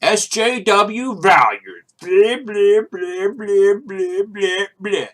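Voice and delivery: loud, high, slow jeering voice; hammed-up mockery, stretching every syllable; mocking imitation. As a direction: jeer it in a silly put-on voice. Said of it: "You don't have to be like".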